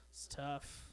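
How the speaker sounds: unvoiced whisper; whispered aside. Real, not acted. Wedding talk to the crowd, weary whisper. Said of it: "whispered aside".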